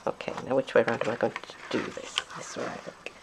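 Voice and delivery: soft, low thinking aloud; hushed, private mumble; muttering to self. Real, not acted. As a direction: murmur it to yourself while working it out. Thinking aloud while lining up.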